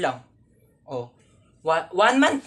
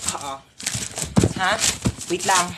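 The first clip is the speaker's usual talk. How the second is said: usual speaking voice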